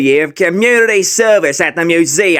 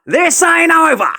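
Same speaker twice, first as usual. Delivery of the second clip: harder threat; 11 semitones higher than usual. Acted. Bully repeats threat louder.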